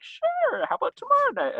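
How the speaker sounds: very high-pitched comic agreement; mock eager acceptance. Couple accepting a dinner invitation, mock eagerness. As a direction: squeak out a keen yes.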